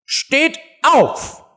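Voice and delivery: very high, piercing queen's cry; piercing shout. A narrator's reading. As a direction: shriek the command as a queen. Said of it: "schrie die Königin mit durchdringender Stimme". (durchdringender Schrei)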